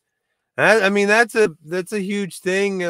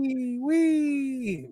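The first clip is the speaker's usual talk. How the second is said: silly squeak; 9 semitones higher, 4 dB quieter than usual. Acted. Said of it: "calm the fuck down".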